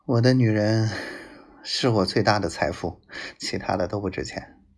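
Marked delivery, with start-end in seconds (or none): none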